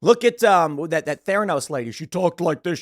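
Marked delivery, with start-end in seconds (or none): 1.9-2.8 a hint of a founder's fake lowered voice. Host imitates that Theranos lady, lightly lowered voice. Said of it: "She lowered her voice".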